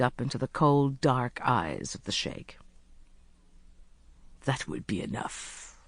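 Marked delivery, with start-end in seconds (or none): 4.4-5.8 low, soft and curt. A narrator's reading. Sheikh stopping her hand, curtly. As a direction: cut it off quietly. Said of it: "he said curtly".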